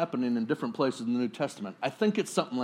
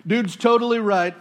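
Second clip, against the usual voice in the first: much higher, louder, gushing agreement; eager teen; 8 semitones higher, 9 dB louder than usual. Retold or imitated. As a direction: jump up and butt in excitedly. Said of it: "the high school sophomore jumps up and says".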